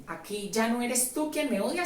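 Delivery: usual speaking voice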